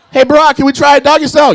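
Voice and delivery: high, loud eager ask; eager request. Retold or imitated. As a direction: call out hopefully to Barack. Asking Obama in bed.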